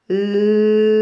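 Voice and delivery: la with a very long, loud "lllla"; exaggerated initial L. Shown as a demonstration. Teacher showing L at the start, la with stretched L. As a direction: hold the L hard before the vowel in "la". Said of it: "j'entends le L au début".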